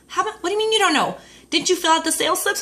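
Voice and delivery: high, fast, disbelieving question; husband grilling her about sales, high and fast; exasperated husband. Retold or imitated. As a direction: fire it off in disbelief.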